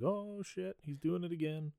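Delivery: a hint of a dazed, resigned mother; catatonic mom. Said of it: "just like".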